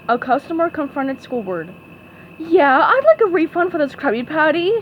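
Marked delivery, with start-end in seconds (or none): 2.4-4.8 complaining. Acted. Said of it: "she complained".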